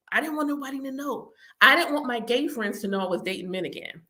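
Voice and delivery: usual speaking voice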